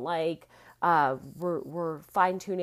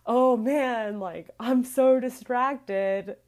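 mock whiny complaint; 4 semitones higher, 6 dB louder, much slower than usual. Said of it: "perpetuating the story of".

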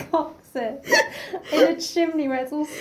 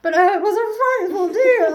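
louder put-on voice through a laugh; posh lizard Bill; 5 semitones higher, 7 dB louder than usual. Bill the lizard recovering after the kick, hammed up. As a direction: ham up a shaken little gentleman. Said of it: "and he's like".

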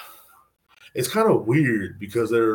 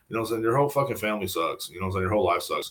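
usual speaking voice

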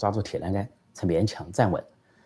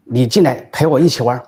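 higher, louder, commanding call; loud demand; 4 semitones higher, 7 dB louder than usual. Acted. Prisoner Sun loudly orders Xi to come in and play, shouting. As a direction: bellow the demand through the bars. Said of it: "又大声说".

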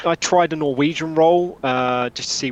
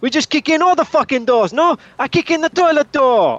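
in-character bravado; 9 semitones higher, 5 dB louder than usual. Acted. In character, pushing for chaos.

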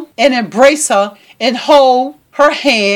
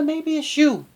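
much softer, dropping the voice to turn it on Regina; pointed quiet aside; 10 dB quieter than usual. Shown as a demonstration. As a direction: slip the blame back at her, softly. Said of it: "you know what, Regina?".

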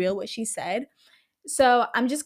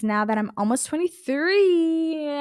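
higher, slow, drawn-out joke age; joking mock announcement; 5 semitones higher, slower than usual. Host jokes about their age to listeners, playfully drawn out. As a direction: stretch out twenty-three in a playful high voice.

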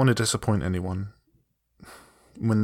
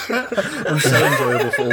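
high laugh then louder giggly words; laughing through it; 8 semitones higher, 5 dB louder than usual. Real, not acted. Host laughing while praising the episode.

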